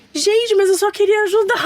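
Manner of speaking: high-pitched mock innocence; mocking meddlers. Host imitates nosy people who claim to help, mocking. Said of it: "lembra que elas falam assim".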